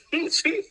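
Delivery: a quick high-pitched laugh; high giggle. Real, not acted. Cohost laughing at a wild comment. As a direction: burst into a giggle at the joke.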